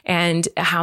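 ordinary talk, nothing marked

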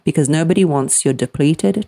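ordinary talk

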